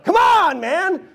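loud, high-pitched exclamation; exasperated shout. Acted. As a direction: cry it out at the top of your voice. Acted outburst at a distracted reader.

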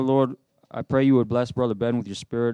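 ordinary talk, nothing marked

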